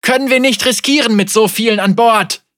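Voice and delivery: high, loud, urgent refusal; panicked protest. Acted. Man refusing the captain's order to cast off, panicked protest. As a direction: shout back at the captain in alarm.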